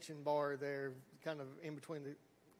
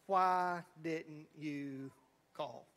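slow, drawn-out disbelief, fading low on call; incredulous; 3 semitones lower, much slower than usual. Retold or imitated. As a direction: stretch it out like a stunned mother. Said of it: "she was almost incredulous".

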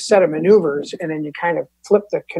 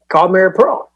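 ordinary talk, nothing marked

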